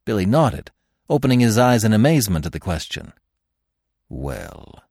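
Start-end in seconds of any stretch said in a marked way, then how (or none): 4.1-4.8 soft, low, weighty pause word; hushed and portentous; impressive. A narrator's reading. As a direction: let it drop low and quiet for effect. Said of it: "exclaimed Falmouth impressively".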